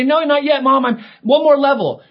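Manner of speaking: put-on high, loud kid protesting; whiny kid. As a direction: whine like a kid stalling. Child whining at parent.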